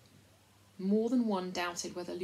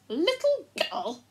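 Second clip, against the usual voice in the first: high, slow, drawn-out disbelief; affronted mouse repeating an insult; loud, scandalised echo, stretched out; indignant outrage; 11 semitones higher, 8 dB louder, slower than usual. A narrator's reading. Mouse indignant at slight to queen.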